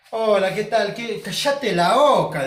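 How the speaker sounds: loud Argentine Spanish, sh for the ll in "Cállate"; brash mock Argentine, sounding out "Cállate" as "cáshate"; Argentine accent. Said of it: "los argentinos hablan".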